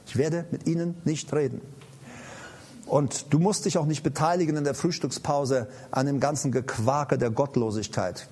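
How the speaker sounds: ordinary talk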